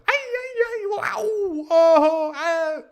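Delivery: rapid volley of high, loud yells; overlapping-style echo cries, hammed up; echoing screams. Shown as a demonstration. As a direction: imitate a scream bouncing off rocks. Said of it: "re-echoed it a score of times".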